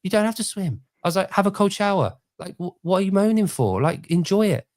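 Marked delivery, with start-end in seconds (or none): 0.0-2.2 higher, pushy mock advice; exasperated retort. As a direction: tell her off with exasperation. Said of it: "I'm like".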